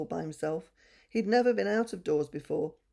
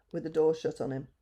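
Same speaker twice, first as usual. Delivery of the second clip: plain reading voice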